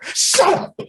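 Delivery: high breathy yelled shut up. Retold or imitated. Killer silencing his girlfriend, high yell. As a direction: snap at her high. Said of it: "He Chris Browns her".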